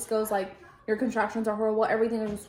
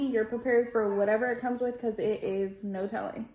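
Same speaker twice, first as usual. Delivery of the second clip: ordinary talk, nothing marked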